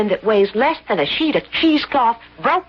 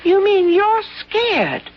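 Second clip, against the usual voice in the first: high, slow, disbelieving question; incredulous; 7 semitones higher, slower than usual. Acted. Landlady asking the professor if he's scared, slow and incredulous. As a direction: stretch it out in disbelief.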